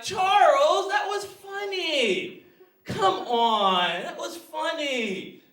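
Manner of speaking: high, drawn-out begging for a laugh; unfunny pleading friend. Retold or imitated. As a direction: imitate a needy joker, stretching the line endings. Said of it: "there was always this guy in our crew".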